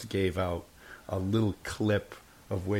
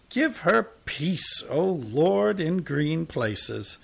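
put-on slow, high, drawn-out prayer like an old woman; drawling old woman's voice; 7 semitones higher, 4 dB louder, much slower than usual. Tramp praying for his mother in a slow, high old woman's drawl. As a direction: drag each word out in a high old woman's whine. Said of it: "in a drawling voice more like an old woman's".

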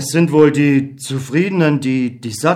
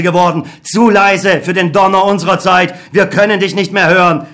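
put-on louder, accusing the old god; bitter reproach; 5 semitones higher, 4 dB louder than usual. Beckmann reproaches God, bitter and louder. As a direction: hurl the blame at him.

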